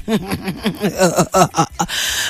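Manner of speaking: low, soft staccato laugh. Real, not acted. Host joking about God's patience, laughing softly. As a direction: laugh softly in a long run of chuckles.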